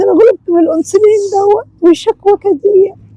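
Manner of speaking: put-on high, frail old woman complaining about the needle; a weary elderly mother fussing. Plays the old diabetic mother.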